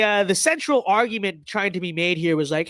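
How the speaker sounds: usual speaking voice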